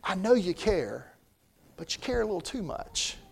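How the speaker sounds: Jesus speaking kindly to worried Martha, gentle; gentle rebuke. Retold or imitated. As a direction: ease her burden gently. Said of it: "he says".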